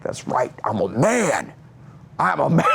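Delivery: put-on declaration rising in pitch; mock manly boast. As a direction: puff it up as a boy claiming manhood. Son declares manhood to mom.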